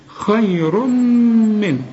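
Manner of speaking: خير من very slow, nun clear, no nasal hum; no ghunna, slow. Shown as a demonstration. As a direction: draw out خير من slowly. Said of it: "ما تغنش هنا".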